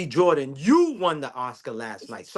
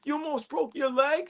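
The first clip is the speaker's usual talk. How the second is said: much higher, gullible fake surprise; mock concern; 10 semitones higher, 4 dB quieter than usual. Guy imitating his old gullible self, higher mock surprise. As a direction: sound falsely impressed by her tale. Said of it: "I used to be all like".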